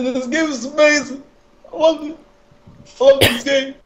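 very high squeaky gushing fan. Retold or imitated. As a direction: gush like an overexcited fan. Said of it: "It's like".

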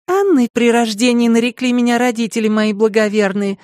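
high, meek and demure; modest. A narrator's reading. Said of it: "скромно потупив глаза". (скромно)

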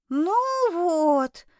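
high, disappointed little voice; upset sigh. Acted. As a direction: sigh it out, let down. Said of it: "расстроенно вздохнул чудик".

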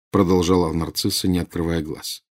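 plain reading voice

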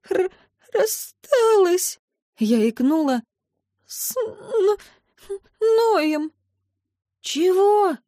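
high, broken sobbing stammer. A narrator's reading. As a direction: choke the words out through tears. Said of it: "всхлипнула я". (рыдая и запинаясь)

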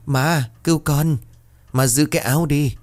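the son's ghost begging his mother through her mouth; possessed son's voice. A narrator's reading. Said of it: "miệng bật thành tiếng của Đạt".

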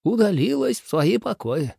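high-pitched minister's voice. A narrator's reading. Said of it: "сказал левый министр".

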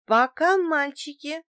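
high, lilting farewell call. A narrator's reading. Woman calls goodbye to the card players, high calling voice. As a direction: sing out a cheery goodbye. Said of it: "крикнула я".

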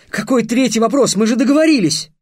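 loud, high indignant protest; outraged. A narrator's reading. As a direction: protest at full voice. Trix protests.

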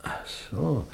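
tonlos gehauchtes Erkennen; geflüstert. Acted. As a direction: leise hauchen, wie ertappt. Plötzliche Erkenntnis über den Warner. (überraschtes Flüstern zum Besucher)

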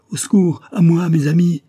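high, loud desperate shout; cry for help. A narrator's reading. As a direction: scream for your sleeping friends. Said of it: "Le chirurgien cria".

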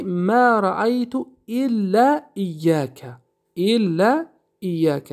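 ما رأیت الا ایاک drawn out slowly; slow recited Arabic example. Shown as a demonstration. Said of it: "مثال".

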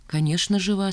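plain reading voice

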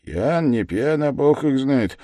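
plain reading voice

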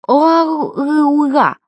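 vowels only, as if the mouth is propped open; mouth full of fingers. A narrator's reading. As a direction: try to speak round fingers in the mouth. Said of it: "все десять пальцев доктора были у неё во рту".

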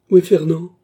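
loud, high, insistent question; urgent demand. A narrator's reading. Frantic woman asking after Fernand, loud demand. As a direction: burst out demanding to know.

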